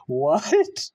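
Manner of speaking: high incredulous laugh into a loud rising what; can't believe what you just heard; shocked laugh. Real, not acted. Reacting to the clip's revelation with a shocked laugh.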